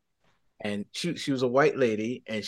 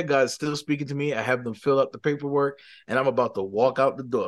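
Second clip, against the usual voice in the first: usual speaking voice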